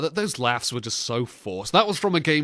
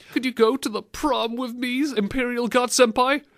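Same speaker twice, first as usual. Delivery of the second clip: put-on higher, squeaky teenage voice; 9 semitones higher than usual. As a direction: squeak like a boy at puberty. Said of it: "squeaking his way through it".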